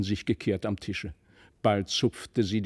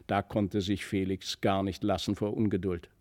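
usual speaking voice

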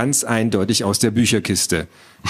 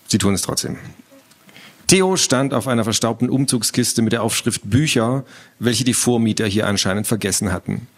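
plain reading voice

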